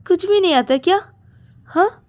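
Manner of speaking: high-pitched irritated challenge; exasperated scolding. Acted. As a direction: demand an answer, fed up. Rahul scolds and pushes Ravi away. (झुंझलाकर ऊँचे सुर में ताना)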